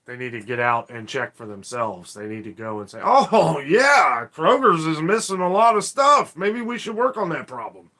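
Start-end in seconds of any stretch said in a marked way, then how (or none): 3.0-7.9 loud, higher sarcastic officials' voice; hammed-up fake surprise, louder and higher; mock realization. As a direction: play the clueless official suddenly noticing. Mocking officials discovering shortages.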